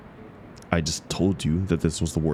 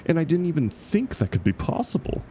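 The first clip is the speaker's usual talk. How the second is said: pitched way up in disbelief; exasperated disbelief; 12 semitones higher than usual. Acted. Ranting to the club about the ending, in high exasperated disbelief. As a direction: sound truly fed up, voice climbing high.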